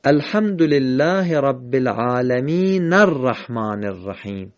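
slow recitation running "العالمین" into "الرحمن" as alaminar-rahman; drawn-out "العالمین الرحمن" in one connected phrase; verses joined. Shown as a demonstration. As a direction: link "العالمین" to "الرحمن" through a short a, no pause. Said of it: "دو آیه رو به هم وصل کردیم".

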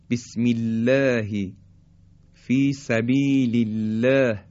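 بسم الله فی سبیل الله with thin L; light, thin lam. Shown as a demonstration. Teacher showing lam after kasra, thin in بسم الله فی سبیل الله. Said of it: "این هم نازکی و ترقیق حرف لام".

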